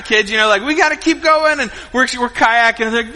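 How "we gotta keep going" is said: higher, mildly panicked retelling; urgent urging. Retold or imitated. Dad pushing his tired kids onward, mildly urgent and higher. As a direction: urge them on in mock alarm.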